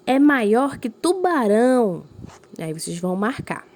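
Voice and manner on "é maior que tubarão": higher and louder, slow amazed reading; surprise. Shown as a demonstration. Teacher demonstrating surprise to pupils, amazed. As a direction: show the class how astonishment sounds. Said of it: "Tá indicando uma surpresa".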